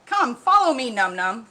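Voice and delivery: loud, high crocodile calling out; a big friendly crocodile calling to the little one. A narrator's reading. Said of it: "the crocodile said".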